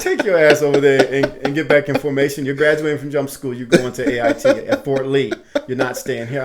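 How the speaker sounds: loud sergeant order with a laugh; loud scolding. Retold or imitated. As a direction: send him back to formation firmly. Staff sergeant orders him back.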